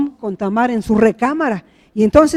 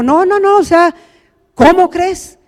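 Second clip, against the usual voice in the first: higher-pitched, protesting no; indignant refusal; 5 semitones higher, 4 dB louder than usual. Acted. Sister refusing her brother's advance, indignant. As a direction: push back in disbelief.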